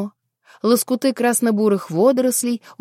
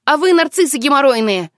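angry shout; 4 semitones higher, 7 dB louder than usual. A narrator's reading. Girl insults the mermen.

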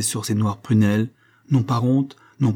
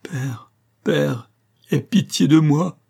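tearful plea; 6 semitones higher, 5 dB louder, slower than usual. A narrator's reading. Daughter at her father's feet begging pity, tearful. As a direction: beg through acted tears. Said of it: "éclata en larmes et sanglots".

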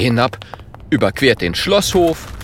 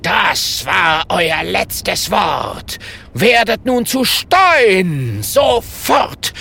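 slow, loud, raised curse from an angry wizard; furious shout; 6 semitones higher, 3 dB louder, much slower than usual. A narrator's reading. The wizard curses the friends into stone, shouting slowly. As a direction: hurl the spell at them.

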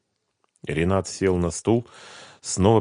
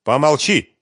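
harsh, loud "shut up", higher; angry; 10 semitones higher, 8 dB louder than usual. A narrator's reading. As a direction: cut her off in anger. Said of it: "гневно прервал её Семён".

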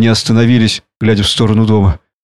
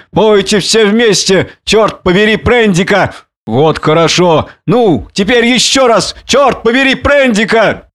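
put-on high, drawn-out drunken cheerleading; crying out; 9 semitones higher, slower than usual. A narrator's reading. Drunken man leads beasts cursing Prendick, crying out. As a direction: lead a rowdy chant, pitched high. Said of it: "услыхал я возглас Монгомери".

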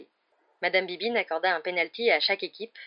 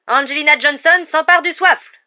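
loud, higher play-by-play call; commentating; 9 semitones higher, 15 dB louder than usual. Acted. Commentator calling a chaser's steal, loud and high. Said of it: "commenta Lee Jordan".

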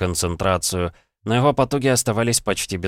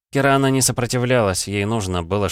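plain reading voice

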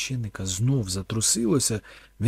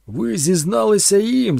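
loud, slow, higher accusing shout; 9 semitones higher, 10 dB louder than usual. A narrator's reading. Thorn shouts at the priest, accusing. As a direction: shout it at him. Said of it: "закричав Торн".

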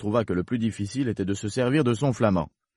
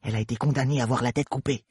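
fast, higher-pitched confidence; confiding rabbit; 4 semitones higher, faster than usual. Acted. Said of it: "ajouta à voix basse".

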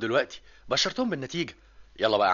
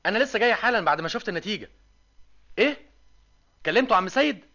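ordinary talk, nothing marked

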